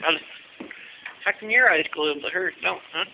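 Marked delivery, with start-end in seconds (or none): none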